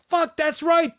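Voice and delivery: high-pitched retold outburst of realization; sudden realization. Recalling an old hatred to co-host, sudden realization. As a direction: blurt it as it dawns on you. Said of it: "it dawned on me".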